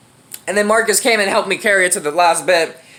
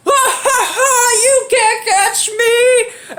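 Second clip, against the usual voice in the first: very high, drawn-out imitated taunt; mock gleeful taunt; 14 semitones higher, much slower than usual. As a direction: crow it like a kid getting away. Imitates friend taunting while fleeing.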